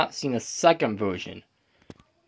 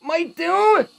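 1 panicked screaming; 13 semitones higher than usual. Acted. Tails panics at scary episode.

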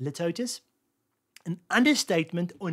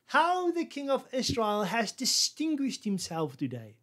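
slow, higher, sneering praise; mocking irony; 6 semitones higher, slower than usual. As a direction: fake praise that drips with scorn. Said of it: "She was actually ridiculing him".

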